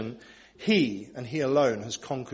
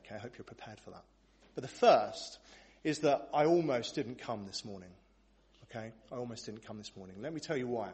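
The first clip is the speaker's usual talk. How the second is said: ordinary delivery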